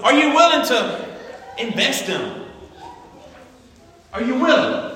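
high, loud shouted preaching; preacher shouting conviction at the congregation; preaching at full force. Acted. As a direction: build the sermon to a shout. Repeated preaching appeal.

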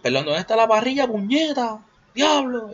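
high, loud panicked cry; frantic yell. Retold or imitated. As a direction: call out in alarm, way up high. Self-correcting, still panicked.

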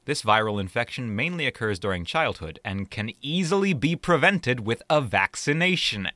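normal talking voice

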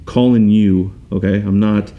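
slow, deliberate, spaced-out words; measured, weighty message with pauses; solemn calling. Retold or imitated. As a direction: speak slowly, letting each phrase land. Retelling God speaking to him.

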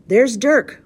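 loud, high call of warning; pirate's announcement. A narrator's reading. Pirate hears the guard's whistle, calling out. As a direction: call out the signal to your mates. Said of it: "one of them pronounced".

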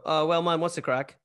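mock Belfast pub greeting. Shown as a demonstration. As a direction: call out a casual Belfast hello. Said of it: "we can say that as, as one word".

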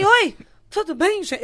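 oi mais agudo, alto e lento, forçando simpatia; oi nervoso e animado. Retold or imitated. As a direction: cumprimenta toda empolgada escondendo o nervoso. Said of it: "Eu já tava nervosa". (oi exageradamente animado)

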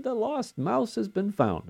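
high, gleeful proclamation; mock-excited announcement. Grandpa playfully announcing the found mouse in a high excited voice. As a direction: announce the find with childlike glee. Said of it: "Now, she didn't say it that way".

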